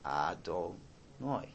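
Adonai drawn out slowly and softly; slow deliberate blessing. Shown as a demonstration. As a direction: linger on each syllable of Adonai. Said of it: "gotta say it a little slower".

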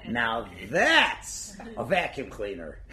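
slow, laughing, mock-admiring line; laughing mock praise. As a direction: praise it while cracking up. Tactful reply, laughing.

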